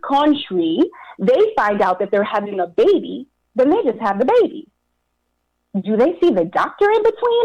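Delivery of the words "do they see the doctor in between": pitched way up, in disbelief; hysterical question. Retold or imitated. Wife asks husband about doctor visits, hysterical and high. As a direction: shriek the question in alarm. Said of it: "hysterical at the thought".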